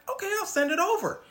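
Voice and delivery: Sarah agreeing to wire the money, high; convinced Sarah. Retold or imitated. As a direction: go along, fooled. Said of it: "So Sarah's like".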